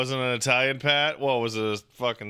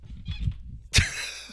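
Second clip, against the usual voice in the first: high wheezing laugh; 4 dB quieter than usual. Real, not acted. Laughing at his own bit.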